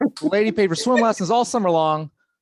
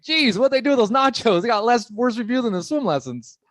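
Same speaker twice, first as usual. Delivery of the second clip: mock surprise; 7 semitones higher than usual. Mocking reader of nacho review.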